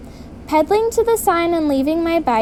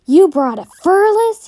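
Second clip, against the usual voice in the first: louder and higher angry challenge; angry growl; 5 semitones higher, 6 dB louder than usual. Acted. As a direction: snap it at her in anger. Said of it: "he growled".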